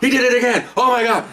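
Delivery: retold high-pitched exclamation of disbelief; upset outburst. Upset colleague bursting out of office.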